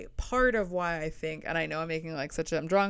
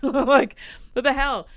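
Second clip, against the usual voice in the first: louder, laughing what the hell; outraged giggle, much louder; laughing disbelief; 7 semitones higher, 10 dB louder than usual. Real, not acted. Laughing at friend's remark.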